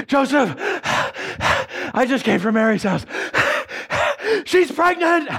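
high, breathless, gleeful shout of news; excited kid cousin. Acted. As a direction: burst in grinning with big news, out of breath. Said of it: "big smile on his face; out of breath".

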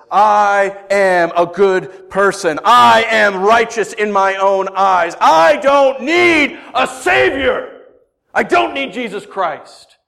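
slow, high shout, every word drawn out; full-force preaching. Acted. Preacher voicing the mentality that rejects a Savior, shouted preaching. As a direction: proclaim it loudly as the self-righteous sinner.

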